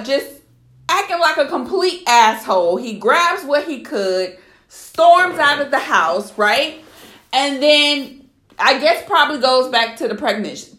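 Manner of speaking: usual speaking voice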